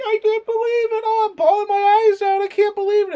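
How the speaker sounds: very high, falsetto mock sobbing fan voice; mocking teary fans. Mocking nostalgic fans to co-host, shrill falsetto mock weeping. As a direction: squeal like an overwhelmed nostalgic fan. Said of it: "everyone's like".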